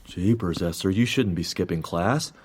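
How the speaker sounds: embarrassed tender reproach; shy mild scold. Acted.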